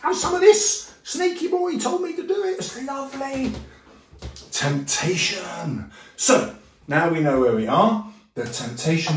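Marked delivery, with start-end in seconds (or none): 0.0-3.5 high squeaky delighted Eve. Retold or imitated. Eve calling Adam over, high squeaky delight. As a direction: squeal it like a giddy girl. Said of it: "then she has it".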